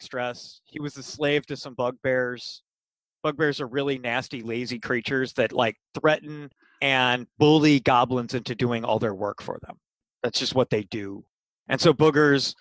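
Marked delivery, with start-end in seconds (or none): none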